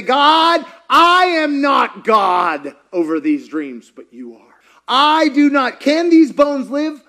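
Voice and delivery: loud, high preaching cry to God; impassioned prayer. Real, not acted. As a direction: cry out in surrender, stretching the words. Prays at full force.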